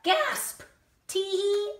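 high spoken gasp and tee-hee; mock gasp and giggle. Reader reacting to the giant's bottom, high gasp and giggle. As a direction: tease the listener.

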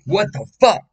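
abrupt high, loud yelp of alarm; sudden startled outburst. Retold or imitated. Speaker re-enacting a flashback mid-sit, startled outburst. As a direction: burst out loudly, pitch shooting up.

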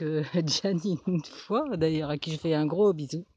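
ordinary talk

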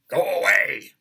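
explosive high angry shout. Shown as a demonstration. Actor shows "he said angrily" in rehearsal, angry shout. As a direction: yell it with sudden full force. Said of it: "He said angrily".

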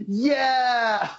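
high, loud whoop of delight; cheering yeah. Retold or imitated. Fan recalls cheering with Spencer as the phone got shot, loud whoop. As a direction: cheer like the shot just landed. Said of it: "Both me and Spencer went".

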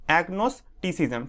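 "agnosticism" slowly, agnos - ticism; broken into syllables. Shown as a demonstration. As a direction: give "agnosticism" in two measured chunks. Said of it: "ভেঙে ভেঙে উচ্চারণ করবে". ("agnosticism" থেমে থেমে ধীরে উচ্চারণ)

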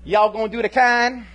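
loud mock Southern accent on Hawaiian pidgin; high, loud Southern-twanged pidgin; Southerner talking pidgin. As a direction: holler pidgin like a clueless Southerner. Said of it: "folks from the southern origin ... start talking pidgin".

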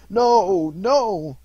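loud, high desperate no; anguished cry. Acted. Scrooge begs the ghost, loud and desperate. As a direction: cry out in horror. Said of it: "said Scrooge".

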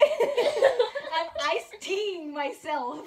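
loud, drawn-out words through laughter; laughing joke. Real, not acted. Friend joking that iced tea is a verb, laughing. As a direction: crack up while saying it, slowly.

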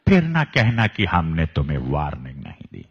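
loud, low suave villain; Ajit impression. Shown as a demonstration. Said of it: "like Ajit had".

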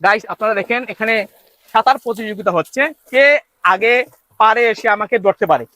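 ordinary delivery

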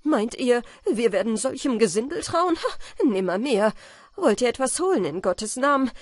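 loud scornful defiance; defiant. A narrator's reading. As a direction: hurl the threat back. Student defies robbers.

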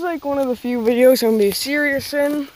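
ordinary delivery

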